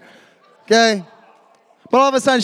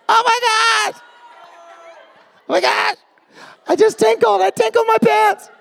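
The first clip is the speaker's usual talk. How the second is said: much higher, squealing mock panic; 13 semitones higher than usual. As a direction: shriek like a starstruck teen girl. Girl freaks out over partner.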